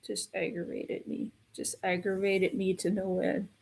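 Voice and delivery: whispered annoyance; fed-up whisper. Real, not acted. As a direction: breathe out the frustration. Venting frustration.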